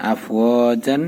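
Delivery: ordinary talk, nothing marked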